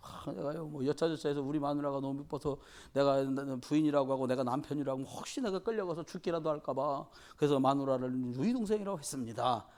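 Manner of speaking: ordinary delivery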